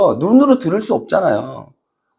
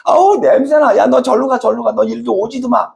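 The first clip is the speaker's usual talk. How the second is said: disgusted shooing; 6 semitones higher than usual. Retold or imitated. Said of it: "여기 있는 애들이 그러는 거야".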